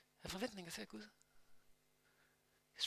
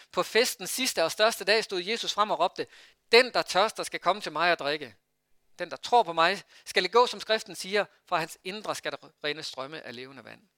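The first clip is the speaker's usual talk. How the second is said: scripture reading; 7 dB louder than usual. Acted. Reads John 7 aloud.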